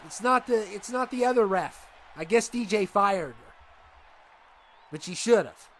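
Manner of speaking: normal talking voice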